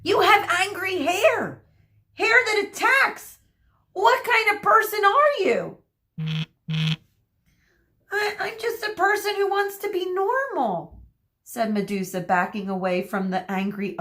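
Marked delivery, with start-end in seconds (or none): 0.0-5.8 high, loud accusing cries; outraged, French accent gone. A narrator's reading. Hairdresser accuses Medusa about her attacking hair, outraged. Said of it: "his French accent suddenly gone". 8.1-11.0 high, hesitant, stammered start; pleading young Medusa. A narrator's reading. Medusa backing away from the hairdresser, high pleading voice. Medusa timidly defends herself.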